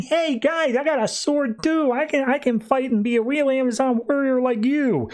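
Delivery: put-on high-pitched eager little-kid voice; young Diana. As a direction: pipe up high like a child wanting to join in. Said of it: "a young Diana comes up saying".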